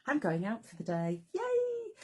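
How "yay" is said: high, gleeful little cheer; excited squeal. Real, not acted. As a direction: squeal it with delight. Excited about day out.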